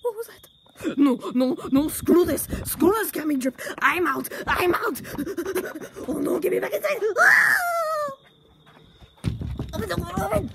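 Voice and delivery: high, loud, frantic panic; terrified yelling. Acted. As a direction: bolt for the tent in terror. Luigi scared again, flees.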